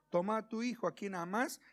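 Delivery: ordinary delivery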